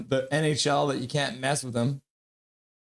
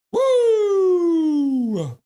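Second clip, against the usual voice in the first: long, high, loud "woo"; piercing whoop that falls at the end; celebratory whoop; 8 dB louder than usual. Real, not acted. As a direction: let out a sustained cheer. Celebrating the baby news.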